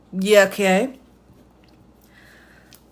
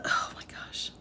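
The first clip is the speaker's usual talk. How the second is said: breathy whispered oh my gosh; whispered aside; 12 dB quieter than usual. Real, not acted. Reader stunned by sloppy brief, whispered exasperation. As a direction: mutter it under your breath in disbelief.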